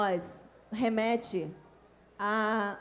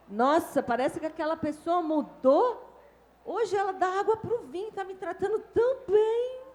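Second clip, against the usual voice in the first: a mock amazed voice pitched up high; pleasantly surprised; 7 semitones higher than usual. Imagined person marvelling that a difficult neighbour changed, mock amazement. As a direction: play up delighted disbelief. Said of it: "você vai falar assim".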